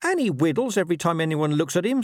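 high, irritable dog snap; snapped. A narrator's reading. Dog mocking his rival, high snappish retort. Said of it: "snapped Gaspard".

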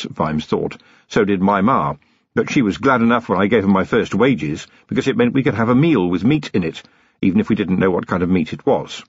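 plain reading voice

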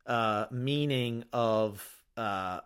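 ordinary talk, nothing marked

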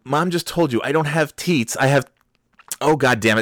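high, loud flustered scolding; exasperated dad. Retold or imitated. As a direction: lose patience and break off in frustration. Exasperated dad scolding his kids.

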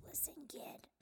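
raspy whispered wise-guy voice; breathy old smoker giving advice; smoker's voice. Pretend. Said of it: "we have a smoker's voice".

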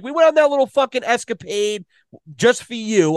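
high-pitched indignant scolding; worked-up, high-voiced complaint; retold exasperated telling-off. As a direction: scold a buddy with the voice pushed up high. Retold quarrel with buddy.

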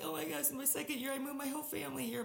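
high-pitched reenacted fretting; retold panic. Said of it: "like, I'm freaking out".

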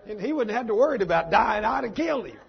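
loud, high comic indignation; mock outrage. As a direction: exclaim it to God in mock exasperation. Preacher mock-rages at God's mercy.

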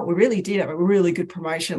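ordinary talk, nothing marked

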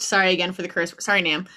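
ordinary delivery

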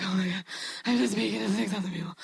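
breathy whispered panic, imitated; mock panic attack. Preacher before speaking to six thousand people, imitated panicked whisper. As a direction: gasp it out like someone freaking out. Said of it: "full-blown panic attack".

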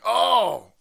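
loud, high "oh" of mock alarm; hammed-up shocked discovery; acted alarmed realization. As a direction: blurt it out as if the demons just appeared. Acts reaction to demons appearing.

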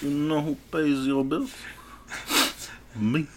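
understated menace of a godfather; Italian mob boss. Acted. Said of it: "like an Italian mob boss".

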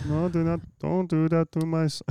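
high, loud Mexican-accented mother impression; mock Mexican accent. Imitating his own mom defending her son, mock Mexican accent. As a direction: protest loudly in a put-on Mexican accent. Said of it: "start Chinese and turn it Mexican".

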